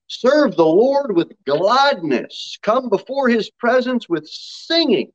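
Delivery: slow, drawn-out psalm reading; scripture reading. Real, not acted. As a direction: read it out to the congregation, stretching the key words. Reads the psalm aloud.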